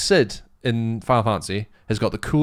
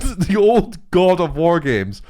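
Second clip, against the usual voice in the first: slow, raised, emphatic voice; excited; 7 semitones higher, 6 dB louder, slower than usual. Real, not acted. Fan finally getting the game's name out, slow excitement. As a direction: push the name out slowly and loudly. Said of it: "I'm just so excited".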